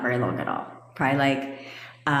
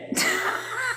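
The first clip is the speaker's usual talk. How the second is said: high giggle; short run of real giggles; self-mocking laugh; 3 dB quieter than usual. Real, not acted. Coach doubts she could sound intellectual, high giggle.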